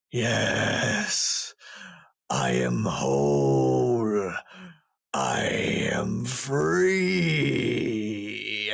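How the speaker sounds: slurred golem voice. Acted. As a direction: drag the words out like a waking stone giant. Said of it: "you hear this kinda slurred voice".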